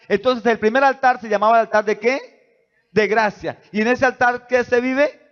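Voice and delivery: high, loud, calling-out sermon voice; preaching at full force. Acted. Pastor questioning the church about the altar, preaching at full force. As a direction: ask and answer with fire.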